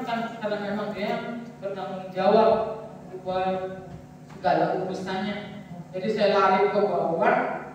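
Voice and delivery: ordinary delivery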